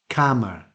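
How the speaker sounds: calmer said like "camera", short front a; regional British variant. Shown as a demonstration. Teacher showing how many British people say it, short front vowel. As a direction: say KAM-uh for calmer, written "camera". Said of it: "pronounce this word as the same as the first one".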